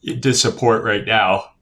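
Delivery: talking through a real yawn; words stretched out of shape by a yawn. Real, not acted. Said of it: "oh, I'm yawning so much".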